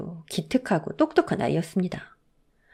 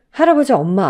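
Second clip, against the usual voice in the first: plain reading voice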